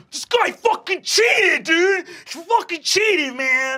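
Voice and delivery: high, loud outraged yell; furious shout. Acted. Half-orc yells that the new kid cheated, furious. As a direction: scream that he cheated. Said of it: "he looks up at you and says".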